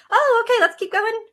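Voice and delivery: cheerful brush-off. Retold or imitated. Parent cheerfully moving a child past a fall. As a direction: chirp it to keep the kid going.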